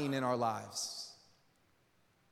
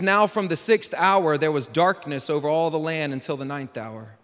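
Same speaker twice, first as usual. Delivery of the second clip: scripture reading; 4 semitones lower, 6 dB louder than usual. Acted. Reads Matthew 27.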